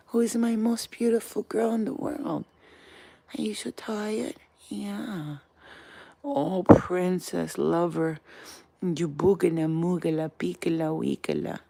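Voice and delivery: slow, drawn-out pet cooing. Real, not acted. Owner to tired little dog, tender baby talk. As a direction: coo sweetly to a sleepy pet.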